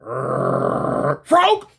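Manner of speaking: growling into a sudden shout; growl then yelp. Retold or imitated. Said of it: "I hear".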